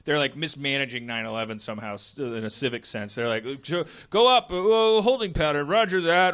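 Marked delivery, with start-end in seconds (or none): 3.7-6.3 high, loud clipped radio call; radio dispatcher. Acted. Radio order in disaster pitch.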